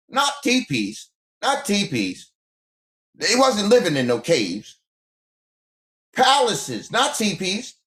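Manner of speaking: worked up and high-pitched, hammering the point; excited. Real, not acted. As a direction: pitch climbing with indignant excitement. Excited rant about palaces.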